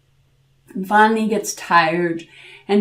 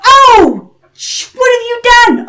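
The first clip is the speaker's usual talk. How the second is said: high, loud yelp of pain; startled devil yell; 10 dB louder than usual. A narrator's reading. Devil woken by a plucked hair, yelling. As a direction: jolt awake shouting.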